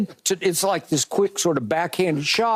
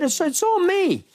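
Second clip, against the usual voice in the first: quoting a player; 12 semitones higher than usual. Retold or imitated. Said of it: "sucked it up after the game and said".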